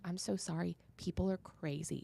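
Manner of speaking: very soft imitated apology; quiet sympathy. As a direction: confide it gently to a shaken stranger. Kind nurse consoling her afterwards.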